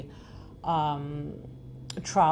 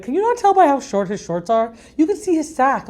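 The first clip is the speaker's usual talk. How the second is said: guy friends teasing; 8 semitones higher, 7 dB louder than usual. Retold or imitated. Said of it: "some of my guy friends would be like".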